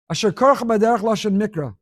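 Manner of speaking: usual speaking voice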